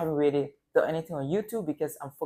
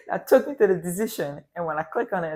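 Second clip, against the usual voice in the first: ordinary talk, nothing marked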